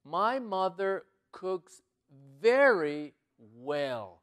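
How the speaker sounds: very slow and loud, word by word; slow model reading. Shown as a demonstration. Teacher showing learners the stronger sentence, slow and loud. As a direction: give every word its own space.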